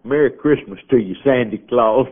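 put-on father hoists the baby, greeting it as Sandy Claus; joyful greeting to baby. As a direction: lift the baby and cheer it. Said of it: "held him up and said".

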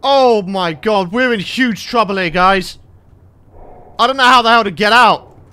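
ordinary talk

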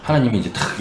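ordinary talk, nothing marked

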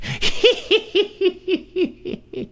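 very high, falling witch's cackle. A narrator's reading. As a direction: cackle wickedly like an old crone. Hag laughs after her taunt.

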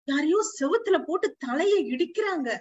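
usual speaking voice

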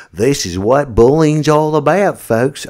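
loud, clumsy Australian impersonation; bad Australian accent. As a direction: do a horribly exaggerated Aussie accent. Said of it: "That's a horrible Australian accent".

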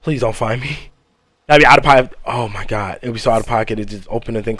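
low, soft frightened whisper; whispering while hiding. Real, not acted. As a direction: barely breathe it out, hiding. Hiding from the ghost.

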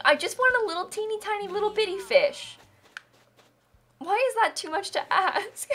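high whimpering plea; whiny mock crying. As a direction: fake sob about a fish. Begging the game for fish.

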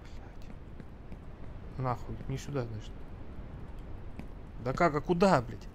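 ordinary delivery

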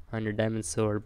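normal talking voice

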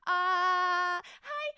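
grand entrance. Pretend. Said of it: "I'm making entrance".

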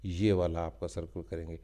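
ordinary talk

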